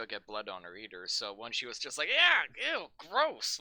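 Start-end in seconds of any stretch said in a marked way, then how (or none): 2.1-3.6 disgusted. Retold or imitated. Retold actress reacting to syrup.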